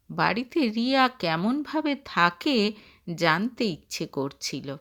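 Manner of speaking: usual speaking voice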